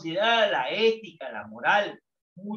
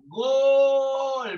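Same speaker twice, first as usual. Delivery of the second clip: full goal shout, much louder and higher; shouting gol; 6 semitones higher, 9 dB louder than usual. Shown as a demonstration. Teacher demonstrates fans shouting goal, loud. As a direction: yell it like a goal in the stadium. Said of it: "Vamos a gritar".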